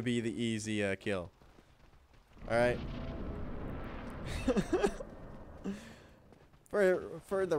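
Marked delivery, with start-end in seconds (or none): 2.8-5.4 breathless giggle. Real, not acted. Laughs before retrying boss.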